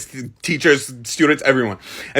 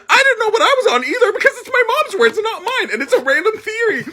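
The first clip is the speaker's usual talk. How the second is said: high, squeaky talk through laughter; really laughing, pitched way up; laughing protest. Real, not acted. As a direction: defend yourself while cracking up. Laughing at her own embarrassment.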